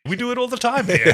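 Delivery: high, light imitation; mock cheerful alien. Host imitating Rigel's cheery line, high. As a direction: toss it off breezily. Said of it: "Ben Browder line retold".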